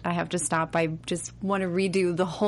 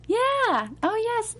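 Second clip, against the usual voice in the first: high sweet dainty princess voice; cartoon princess; slower than usual. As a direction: sing it out like a Disney princess. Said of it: "like a princess voice".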